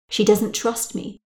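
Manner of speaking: ordinary delivery, nothing marked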